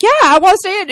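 loud, high mock protest; insistent player. Player insisting to teammate he wants in, mock indignant yell. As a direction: blurt it high and loud, imitating an eager player.

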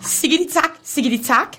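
mock Japanese. Continuing the manga story for mum to translate, mock Japanese. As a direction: recite nonsense as if Japanese, drawing out the last phrases. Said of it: "Hun tager den på japansk".

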